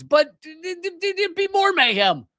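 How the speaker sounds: very high, flustered stammer; worked-up stammer. Real, not acted. Host ranting about world chaos, sputtering high. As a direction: sputter with exasperation, voice way up.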